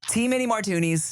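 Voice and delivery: "tee many martoonies", vowels swapped; jokey mispronunciation. Acted. Son repeating a Conan joke, "martinis" as "martoonies". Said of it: "I heard it from Conan O'Brien".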